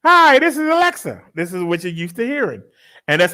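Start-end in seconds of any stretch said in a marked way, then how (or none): 0.0-1.2 high, louder chirpy assistant voice; higher mock Alexa greeting. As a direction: sound like a friendly voice assistant. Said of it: "even the Amazon thing".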